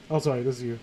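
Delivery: ordinary talk, nothing marked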